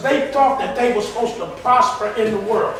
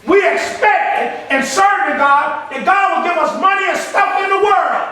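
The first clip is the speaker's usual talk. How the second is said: raised, forceful preacher's voice; full-force preaching; 8 semitones higher, 5 dB louder than usual. Real, not acted. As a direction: build higher and louder as you preach it.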